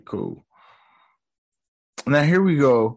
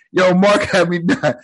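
laughing; 7 semitones higher, 4 dB louder than usual. Real, not acted. Cracking up at the scene.

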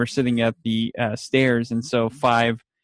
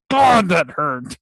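slow, higher, hammed-up ouch; milk the fake pain slowly; mock pain; 5 semitones higher, 4 dB louder, slower than usual. Klaus hamming it up after smashing a globe on his head. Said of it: "And he's like".

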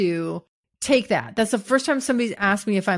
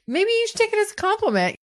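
much higher, quick light mock male voice; voicing a listener; 12 semitones higher, faster than usual. Quoting the voicemail guy teasing her, high and quick. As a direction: toss off the suggestion playfully. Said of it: "And he was like".

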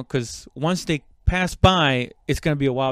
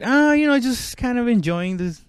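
comic innocent act; 9 semitones higher than usual. Retold or imitated. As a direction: pretend you're just out enjoying the view. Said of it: "he told him to me in a comedic way".

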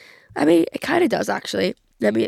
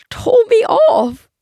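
high, loud, drawn-out squeal; scandalised squeal, stretched out; mock outrage; 13 semitones higher, 8 dB louder, much slower than usual. Real, not acted. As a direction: wail it slowly in comic disbelief. Outrage at being told off.

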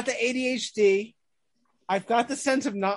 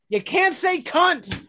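higher, slow mock reprimand; shocked scolding; 7 semitones higher, slower than usual. Supervisor scolding a performer after a kids' show, shocked reprimand. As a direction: scold in disbelief, drawn out.

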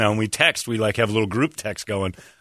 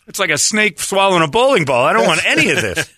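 disgusted; 7 semitones higher, 5 dB louder than usual. Real, not acted. Disgusted rant.

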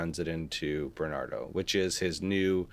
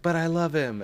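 lovestruck Maria; 9 semitones higher than usual. Retold or imitated. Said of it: "she's just like".

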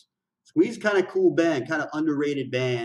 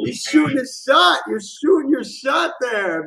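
high, loud cheer; excited shout; 9 semitones higher, 7 dB louder than usual. Real, not acted. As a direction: whoop it up for him. Cheering singer's flirting.

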